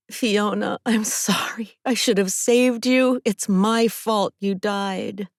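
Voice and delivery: high, breaking half-whispered cry; anguished wail. A narrator's reading. Wailing apology to the drowned friend, anguished. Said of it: "I fall to my knees and wail".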